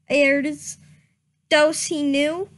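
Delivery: usual speaking voice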